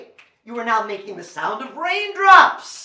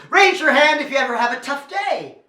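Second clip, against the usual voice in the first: rally the room with a raised voice; loud audience call-out; louder call; 5 semitones higher, 6 dB louder than usual. Real, not acted. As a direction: call out to the crowd loudly.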